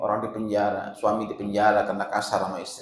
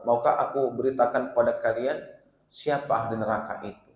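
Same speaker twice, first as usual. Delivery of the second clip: normal talking voice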